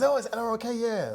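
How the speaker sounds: high, bright confirmation; delighted festival guy. Retold or imitated. Festival guy confirming the guess, high delighted. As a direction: play the pleased rum fan.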